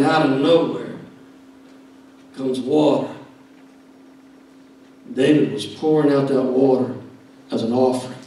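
normal talking voice

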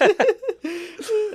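high, bright burst of laughter. Real, not acted. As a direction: laugh out at the coincidence. Laughing at the realization.

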